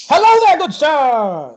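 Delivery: loud, high, drawn-out showman's call; booming barker greeting. Acted. Barker startling you and your siblings, booming greeting. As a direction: holler it slowly like a carnival barker.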